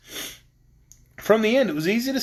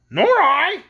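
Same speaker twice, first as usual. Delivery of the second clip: hen voice; 10 semitones higher, 6 dB louder than usual. A narrator's reading. Billina the hen answers.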